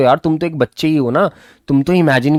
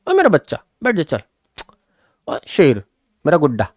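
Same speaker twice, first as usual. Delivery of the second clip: put-on higher, doting teacher voice coaxing a child; kindly teacher; 5 semitones higher than usual. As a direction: pamper the boy like a fond elder. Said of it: "kindly बोला।". (ऊँची, लाड़ भरी आवाज़ में बच्चे को पुचकारते हुए)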